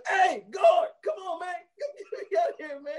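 high, frantic calls for help; panicked yelling for guard. Retold or imitated. Chris Tucker panicking in the cell, calling the guard. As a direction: scream for the guard like he's trapped. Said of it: "the first thing he says".

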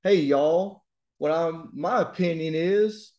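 drawn-out Southern twang for a demo; Southern accent. Shown as a demonstration. Teacher showing students a regional American choice, Southern accent. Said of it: "Do you wanna have a Southern accent?".

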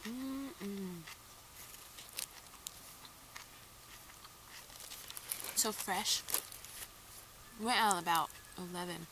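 speaking between bites of food; talking while chewing. Real, not acted. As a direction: mouth partly full. Seen 1: Bites sandwich at -9, lips pursed and cheeks working through the words.

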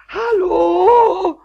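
high, loud, drawn-out hello; desperate call. Acted. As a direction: call after someone who's gone. Caller hung up.

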